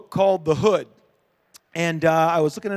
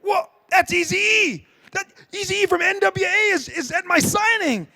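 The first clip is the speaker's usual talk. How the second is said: high, excited double take; you can't believe who's standing in front of you; a pitched-up gasp of recognition; starstruck disbelief; 11 semitones higher than usual. Retold or imitated. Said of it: "And I said".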